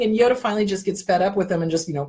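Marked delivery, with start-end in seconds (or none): none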